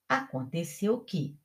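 ordinary delivery

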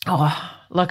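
ordinary delivery

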